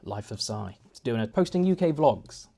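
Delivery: ordinary delivery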